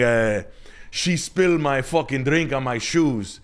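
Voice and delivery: high-pitched put-on Dominican accent telling a club story; Dominican buddy accent. Retelling a buddy's club story in a Dominican accent. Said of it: "crazy Dominican story".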